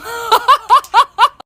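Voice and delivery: loud, high burst of laughter; real laugh that breaks out; big laugh. Real, not acted. Mom laughing at her own story, big real laugh.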